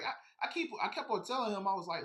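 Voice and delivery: usual speaking voice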